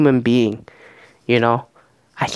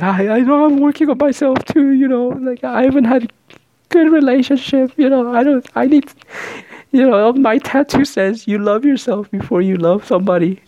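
high-pitched mock emotional contestant; Lace; 12 semitones higher, 4 dB louder than usual. Contestant eliminating herself to the bachelor, high mock distress. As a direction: imitate her tearful self-help speech, far higher and louder.

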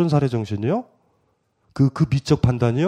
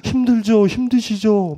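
put-on child's question; 5 semitones higher, slower than usual. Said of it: "어떤 애가 그래요".

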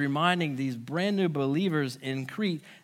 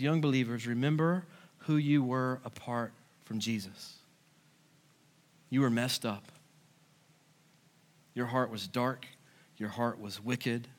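hushed earnest warning; 8 semitones lower, 5 dB quieter than usual. Pretend. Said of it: "And so Paul says".

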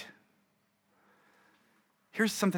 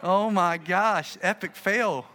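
slow, louder mock dismay; 2 semitones higher, 5 dB louder, much slower than usual. Pastor reacting to a pointing churchgoer, slow louder mock dismay. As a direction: drag out the groan at the failure. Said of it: "I was like".

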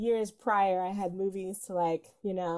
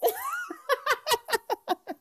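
long, high-pitched laugh. Real, not acted. Host laughs after telling everyone to shut up. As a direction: let the laugh ride up high.

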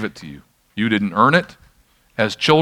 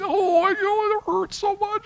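very high, softer imitation of a hurting daughter; mock pained wail; 5 dB quieter, slower than usual. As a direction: squeal in put-on pain, way up high. Daughter hurt, slammed finger in door.

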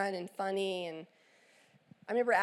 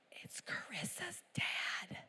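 urgent unvoiced whisper, a touch higher and slow; 8 semitones higher, 11 dB quieter, slower than usual. Teen son whispering to mom who's on the phone. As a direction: hiss it so the caller can't hear.

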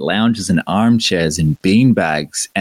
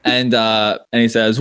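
normal talking voice

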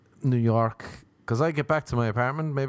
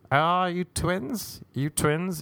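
higher-pitched calling out, drawn-out start; mock street call; 6 semitones higher, much slower than usual. Stranger asking two friends on the street, mock calling out. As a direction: imitate a nosy passer-by calling over. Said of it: "people just shouting".

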